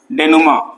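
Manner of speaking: डेनुमा with the final consonants silent; pronunciation of डेनुमा. Shown as a demonstration. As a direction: say डेनुमा ending on an open 'maa'. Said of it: "इसको डेनुमा पढ़ते हैं". (डेनुमा का उच्चारण, आख़िर में खुला 'मा')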